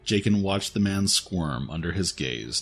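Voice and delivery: usual speaking voice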